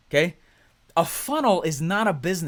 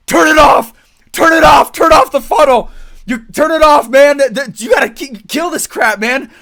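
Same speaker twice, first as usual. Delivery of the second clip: much higher, very loud frantic yell; panicked shouting; 11 semitones higher, 15 dB louder than usual. Retold or imitated. Client on day three begging to shut the funnel, frantic shouting. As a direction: scream at him to kill it. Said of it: "this is usually what they would say".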